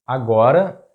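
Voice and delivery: agora with the middle GO stressed, rising as a question; stress on middle syllable. Shown as a demonstration. Teacher offering learners a second option, agora stressed on GO. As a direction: offer agora as a-GO-ra, tune lifting at the end. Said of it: "The correct pronunciation is agora".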